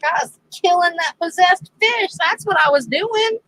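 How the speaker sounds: high-pitched comic self-defence; mock indignation. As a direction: insist on it, retelling the protest. Retells her indignant self-defence.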